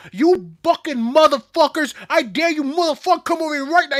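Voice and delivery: high, loud retold scream; screaming Boston friend. Friend cursing out the guys who splashed them, screaming. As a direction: imitate a furious Boston buddy yelling. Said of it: "He just starts screaming".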